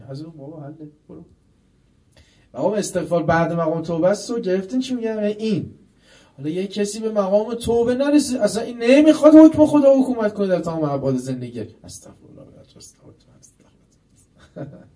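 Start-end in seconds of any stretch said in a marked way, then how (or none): 2.5-11.6 climbing to a loud, high preacher's cry; fiery preaching. Acted. Preacher urging listeners toward true repentance, rising to a loud cry. 11.6-14.8 breathy, hushed muttering of astaghfirullah; murmured istighfar. Pretend. Preacher mimicking someone mumbling repentance, a soft mock whisper.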